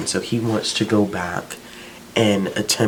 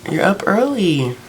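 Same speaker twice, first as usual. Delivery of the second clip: high sing-song greeting; 14 semitones higher, slower than usual. Real, not acted. Calling a cheerful good morning to someone just up. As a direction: greet them in a sugary high voice.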